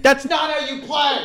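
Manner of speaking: put-on high, whiny kid's protest; a five-year-old. Adult playing Uno with a child, high whiny protest. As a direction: squeal it like an indignant little kid. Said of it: "and you're like".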